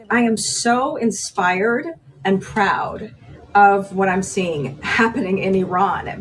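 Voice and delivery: usual speaking voice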